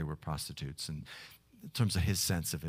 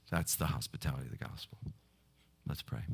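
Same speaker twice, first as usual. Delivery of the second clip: ordinary talk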